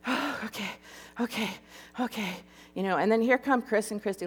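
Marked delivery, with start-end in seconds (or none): 0.1-2.4 soft, breath-filled self-calming; steady yourself between deep breaths; breathy regrouping. Retold or imitated. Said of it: "taking really deep breaths and trying to regroup".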